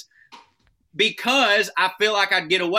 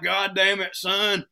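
overstuffed, higher and louder groan; a pained, full-bellied complaint; groaning in food pain; 5 semitones higher, 5 dB louder than usual. Retold or imitated. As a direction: whine like you ate too much. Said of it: "I'm like".

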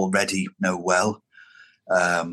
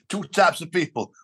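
laughing; 6 semitones higher than usual. Real, not acted. Repeating a joke line, laughing.

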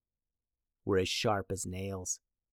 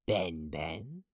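low witch voice; 6 semitones lower, 7 dB quieter than usual. Acted. Soothsayer character voice.

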